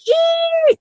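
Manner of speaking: very high sharp squeal of pain. Shown as a demonstration. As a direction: cry out as a car door shuts on your hand. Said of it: "you're gonna be like, making a noise".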